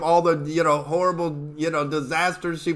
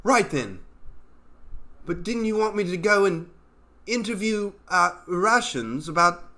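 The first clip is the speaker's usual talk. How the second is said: mock British spy; 3 semitones higher than usual. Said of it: "You limey fuck".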